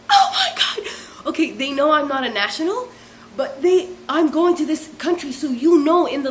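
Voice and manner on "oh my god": very high, laughing squeal; aghast. Retold or imitated. Reacting to the cucumber rumour, laughing aghast squeal. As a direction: squeal it out in mock horror.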